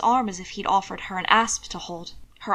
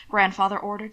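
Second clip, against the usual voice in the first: ordinary delivery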